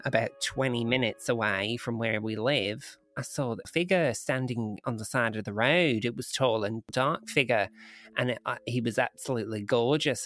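lightly put-on Australian accent. Woman recounting a UFO encounter, in a rough Australian accent. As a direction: read it as an Australian witness. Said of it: "doing single-handedly to the Australian accent".

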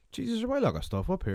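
surprised. Acted. Marvelling at stuff up in the attic, surprised. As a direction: act out being taken aback. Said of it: "you're just all".

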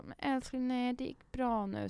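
calm the daughter tenderly; reassuring mother. Retold or imitated. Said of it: "jag bara". (mamma lugnar mjukt och långsamt)

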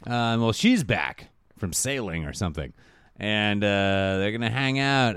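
normal talking voice

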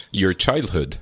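normal talking voice

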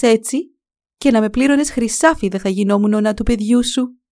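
high, loud, outraged tirade; angry scolding. A narrator's reading. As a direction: throw the poor man out of her house. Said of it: "απάντησε εκείνη θυμωμένα". (οργισμένη επίπληξη, δυνατά)

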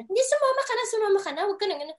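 high, loud coaxing of a friend; best friend insisting she join the audition; pushy best friend. Retold or imitated. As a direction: urge her to just come along. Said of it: "Sabi kong ganun".

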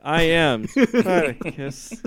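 slow, high squeak on a heroic line; squeaky. Shown as a demonstration. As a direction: mimic your own voice squeaking, exaggerated. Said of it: "just sort of squeak a little bit".

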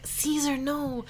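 badly faked, playful Chicago accent; failing Chicago accent. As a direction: attempt a Chicago accent and lose it. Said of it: "No, I can't do it. I've lost it".

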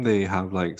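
ordinary delivery

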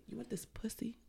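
whispered "You want this pussy" with every sound crisply formed; over-enunciated. Acted. Demonstrating the voice to co-host, "this pussy" whispered and over-enunciated. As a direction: whisper "this pussy", hitting each S clearly. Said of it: "everything's enunciated".

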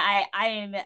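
normal talking voice

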